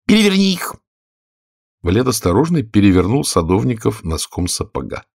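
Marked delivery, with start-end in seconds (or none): 0.1-0.7 high, cross royal order; angry command. A narrator's reading. Angry queen orders the knave about, high and cross. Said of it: "сердито отвернулась от него и приказала".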